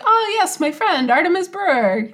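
sarcastic friendly recognition; hammed-up husband spotting the name; recognizing husband. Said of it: "he's like".

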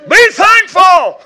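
very loud, high, drawn-out holler. Retold or imitated. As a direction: holler it slowly to wake the house. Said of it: "she heard me hollering".

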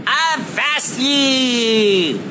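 slow drawn-out pirate yell; shouting from crow's nest. Acted. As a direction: bellow it down from the mast. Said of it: "Alex yelled from the crow's nest".

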